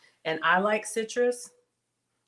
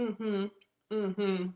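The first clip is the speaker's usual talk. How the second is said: ordinary talk, nothing marked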